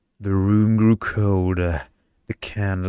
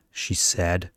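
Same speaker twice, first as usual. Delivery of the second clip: ordinary delivery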